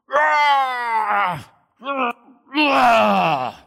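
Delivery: loud, high straining roars; weightlifter's roar. Retold or imitated. Big old dude lifting the bar, roaring loud. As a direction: grunt it out like lifting huge weight. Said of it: "and he goes".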